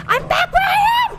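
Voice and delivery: high despairing shout; panicking gamer. Real, not acted. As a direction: yell in frantic disbelief. Sent back in the game.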